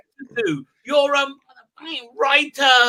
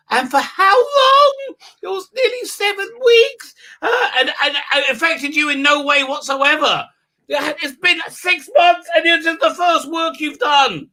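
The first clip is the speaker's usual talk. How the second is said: higher, half-laughing incredulous rant; mock outrage; 7 semitones higher than usual. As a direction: sneer at the claim in raised disbelief. Mocking outrage at the statement.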